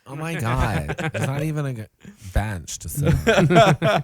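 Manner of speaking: put-on high, slow, drawn-out valley girl complaint. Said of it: "no more Valley Girl".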